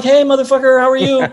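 high, loud, jovial retold hello; boisterous greeting back. Friend answers the director's profane greeting, high and boisterous. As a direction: return a buddy's profane hail cheerfully.

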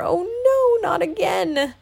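high, exasperated groan of words; muttered dismay. A narrator's reading. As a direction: lament it high, easing off at the end. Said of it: "just enough time to mutter".

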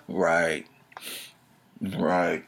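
low, soft "right"; quiet, low-pitched mock agreement; sarcastic aside. Real, not acted. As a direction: drop the voice for a dry, knowing agreement. Muttered sarcastic answer to own question.

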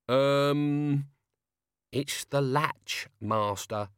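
put-on tired, patient old servant; weary. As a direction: explain it wearily. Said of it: "said Albert wearily".

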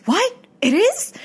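imitating a startled clerk, pitch jumping up; shocked disbelief. Clerk hearing the room is haunted, imitated with high startled disbelief.

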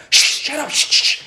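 high, urgent shushing; acted-out hushing. Family near the explosion hushing each other, urgent mock hushing. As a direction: imitate people hissing for silence.

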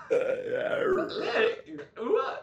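very high, very slow squeak; voice cracking. Retold or imitated. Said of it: "my voice just broke".

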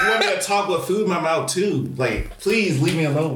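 high, fed-up pleading, slowed down; exasperated retort. Retold or imitated. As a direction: beg them to let you eat. Acted irritated retort.

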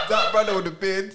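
high, loud laughter; laughing hard. Real, not acted. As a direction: crack up while saying it. Laughing about show.

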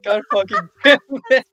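loud, high frustrated outburst; exasperated cursing. Real, not acted. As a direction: curse loudly at being derailed. Curses as bit derails again.

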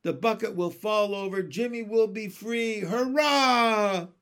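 high, excited chorus building to a loud cheer; froglets cheering. A narrator's reading. Froglets cheer for their brother's rescue, excitedly shouting. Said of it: "shouted the froglets".

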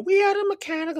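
squeaky high mock voice; mocking airline excuse.

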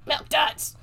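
high, squeaky little gremlin cry; excited gremlin shriek. Retold or imitated. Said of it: "the gremlin next to him is like".

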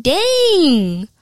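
loud, high, drawn-out retold exclamation; exasperated outburst. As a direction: burst out in mock dismay, stretching the word. Retold exasperated reaction to teacher.

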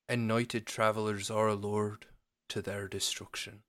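verse recited with a Scottish accent; measured Scottish-accented recitation. Shown as a demonstration. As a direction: read the poem like a Scot. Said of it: "read it in a Scottish accent".

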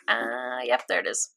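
low, soft dawning recognition; quiet realisation. Retold or imitated. Listener telling how a voice clicks, quiet realisation. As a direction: let it sink in, low and quiet. Acted sudden realisation in retelling.

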